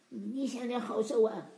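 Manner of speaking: ordinary talk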